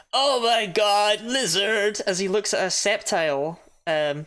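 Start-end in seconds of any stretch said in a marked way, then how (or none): 0.1-2.0 slow, louder dazed "Oh my god, lizard!"; stoned guy's awe. Retold or imitated. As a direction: drawl it like a stoned guy amazed by a lizard. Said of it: "a guy walking around his room with a webcam going".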